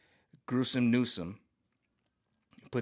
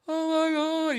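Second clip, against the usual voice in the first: mock gasp; 7 dB louder, slower than usual. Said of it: "So many people were just like".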